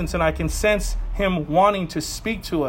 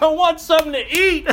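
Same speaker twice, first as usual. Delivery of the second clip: hungry craving; 7 semitones higher than usual. Retold or imitated. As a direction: whine for food half laughing. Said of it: "I'm always like".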